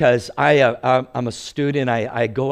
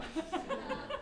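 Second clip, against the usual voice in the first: laughing; 19 dB quieter than usual. Real, not acted. Laughing at his joke.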